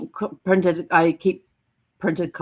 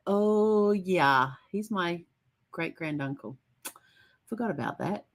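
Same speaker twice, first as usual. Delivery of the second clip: much softer, trailing away embarrassed; sheepish; 3 semitones higher, 5 dB quieter, slower than usual. Retold or imitated. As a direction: caught out, mumbling it down. Sheepish realisation of kinship.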